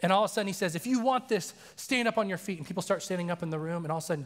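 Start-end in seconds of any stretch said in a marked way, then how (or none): 0.8-2.6 raised-pitch imitation of a preacher's invitation; pastor's altar call. Pastor calling people to respond, voice raised. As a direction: lift the voice, urging the room.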